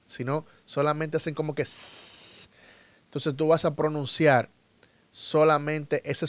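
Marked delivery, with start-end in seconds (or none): 1.6-2.5 "s" as a long pure hiss with no vowel; snake hiss. Shown as a demonstration. Teacher imitating a snake for English learners, drawn-out hissed "s". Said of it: "ese sonido que hacen las serpientes".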